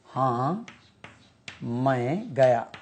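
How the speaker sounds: हाँ, pause, then मैं गया said slowly; slow teacher's model of हाँ मैं गया; slow, split demo. Shown as a demonstration. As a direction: break the answer apart for students. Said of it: "Yes, I went".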